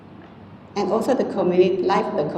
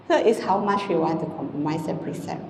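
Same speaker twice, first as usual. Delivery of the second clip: ordinary talk, nothing marked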